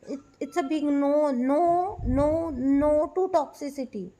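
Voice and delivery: very slow, every word weighted; emphatic slow no. Real, not acted. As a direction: drive the refusal home word by word. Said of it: "इतना जोर देकर ये बात बोल रही हूँ". (बहुत धीरे, हर शब्द पर ज़ोर)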